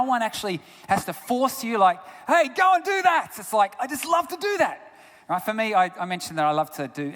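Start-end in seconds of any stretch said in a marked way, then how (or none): 2.3-3.3 high, loud bossy call; mock order. Preacher voicing a pushy voice, mock order. Imagined someone forcing you. 3.8-4.9 eager. Retold or imitated. Imagined enthusiastic person.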